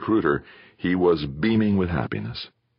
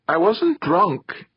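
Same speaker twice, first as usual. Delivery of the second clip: tipsy protest; 14 semitones higher, 3 dB louder, much slower than usual. Acted. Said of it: "Then she hiccuped".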